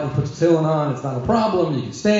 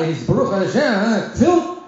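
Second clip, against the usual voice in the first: ordinary talk, nothing marked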